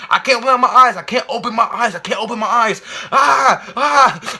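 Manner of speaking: high, loud panicked cry; frantic half-sobbing yell. Retold or imitated. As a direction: shriek it like you can't see. Panicked retelling, can't open eyes.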